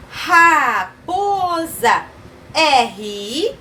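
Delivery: high, very slow syllables. Shown as a demonstration. Teacher naming a picture for children, syllable by syllable. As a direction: stretch out each syllable.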